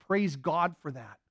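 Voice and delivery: ordinary talk, nothing marked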